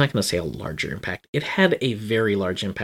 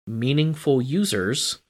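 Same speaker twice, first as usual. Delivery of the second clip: MEANINGFUL USERS set apart, higher and slow; spoken air quotes; 4 semitones higher, slower than usual. Real, not acted. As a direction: put slow audible quote marks round MEANINGFUL USERS. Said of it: "I'm doing air quotes".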